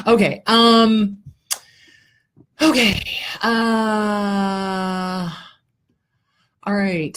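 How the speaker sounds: fighting back real tears; real crying breaking through; starting to cry. Real, not acted. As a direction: voice catching with real tears, drawn out slowly. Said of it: "starting to cry".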